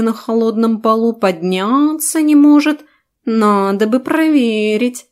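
slow, sly needling cat; teasing cat voice. Acted. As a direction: egg him on like a sly cat. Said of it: "подначило меня это существо". (лукавый подначивающий кот, медленно)

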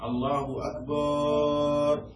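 أكبر sung slowly with the ba stretched long, like a muezzin's call; drawn-out akbar. Shown as a demonstration. Teacher demonstrates a muezzin's mistake, stretching أكبر slowly. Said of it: "هكذا".

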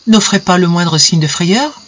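ordinary delivery

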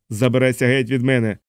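ordinary delivery, nothing marked

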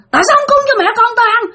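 high, rapid-fire shriek; angry screaming ghost. Retold or imitated. Ghost mother turning on the sleeper, shrieking fast and high. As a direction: snap into a fast, scolding yell. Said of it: "Nạt lên, la làng lên các bạn".

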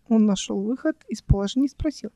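ordinary delivery, nothing marked

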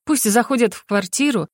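plain reading voice

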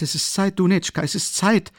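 noch höher, Zeit steil ansteigend; noch aufgeregter. A narrator's reading. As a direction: die Unruhe steigert sich. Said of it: "regte sich Pulcheria Alexandrowna auf". (Mutter ruft Dunetschka, noch höher und drängender)

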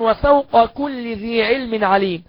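"وفوق كل" slowly as wa-fawqa kulli; unmerged qaf. Shown as a demonstration. Teacher reciting a counter-example, q and k kept apart in "وفوق كل". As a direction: keep the ق of "وفوق" distinct from the k of "كل". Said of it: "لا، ما ندغمش".

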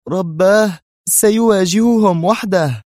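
drawn-out, high-pitched alarm; frightened cry. A narrator's reading. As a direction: cry out in dread for someone else. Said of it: "فارتجف قلب منى بين ضلوعها وهي تقول".

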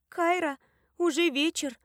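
high, worried voice; concerned. A narrator's reading. Nani waking a friend at evening, concerned. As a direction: call the sleeper with concern. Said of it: "Голос Нани звучал озабоченно".

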